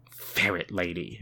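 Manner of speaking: low, soft, slowed murmur. A narrator's reading. Woman talking to herself about a ferret, murmured. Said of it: "she murmurs".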